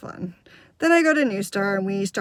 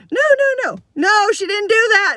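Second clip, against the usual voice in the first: high, loud string of refusals; imagined urgent protest; 10 semitones higher, 6 dB louder than usual. Retold or imitated. Girl protesting as her part goes to another, urgent. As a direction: burst out as a kid grabbing the part back.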